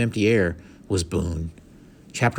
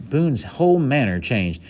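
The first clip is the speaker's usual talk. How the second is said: usual speaking voice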